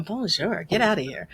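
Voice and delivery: mock grumpy French greeting; dismissive Parisian "Bonjour"; foreign accent in french. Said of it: "grumble about Americans".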